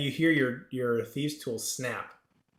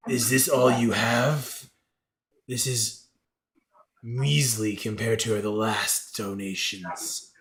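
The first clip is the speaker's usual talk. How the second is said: slow, lower creature voice; displeased creature god; 3 semitones lower, slower than usual. Acted. God belittles the player's offering, displeased. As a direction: weigh each word slowly, unimpressed.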